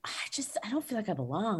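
soft, whispery voicing of a hesitant confession; imitated self-doubting young woman. Young woman confiding imposter feelings to a mentor, hesitant and hushed. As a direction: confide it softly, almost without voice. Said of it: "young women come to you, me too, say".

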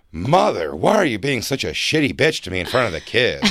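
drawn, swooping camp-dad impression. Reality-show dad scolding mother, camp mock indignation. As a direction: swoop through it like an affronted camp husband. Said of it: "he's like the dad".

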